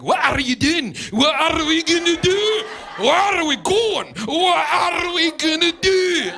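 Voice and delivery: loud, high, slow hammed-up Scottish accent; mock Scottish accent. Mocking how Scots talk at his shows, hammed-up Scottish accent. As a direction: strain it like a Scot, straining vein. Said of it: "talk like that".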